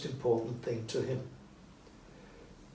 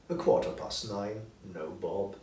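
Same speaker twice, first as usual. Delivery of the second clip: ordinary delivery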